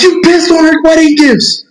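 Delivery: high, sustained mock accusation; hammed-up maternal scolding; retold angry mom. Voices angry mom accusing him.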